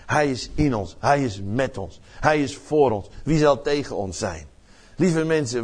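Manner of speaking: usual speaking voice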